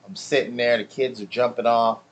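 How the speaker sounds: ordinary talk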